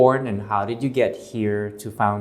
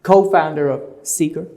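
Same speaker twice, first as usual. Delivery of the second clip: bright, fast show-opening greeting, louder at first; show host intro; 5 semitones higher, 6 dB louder, faster than usual. Acted. Host welcoming viewers to the show, upbeat presenter intro. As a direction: hype up the viewers as the show begins.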